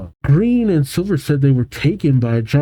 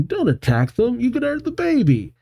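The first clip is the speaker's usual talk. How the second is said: high-pitched urgent plea; mock-frantic warning; acted alarm; 10 semitones higher, 3 dB quieter than usual. Officer grabs Gold to stop him.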